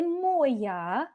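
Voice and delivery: моя misstressed as MOY-ya, drawn out; wrong stress. Shown as a demonstration. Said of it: "don't say".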